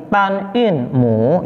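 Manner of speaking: "tan in mu" slowly, syllable by syllable; "tan in mu" in slow, measured Mandarin syllables; Mandarin term demo. Shown as a demonstration. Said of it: "Yang pertama tadi".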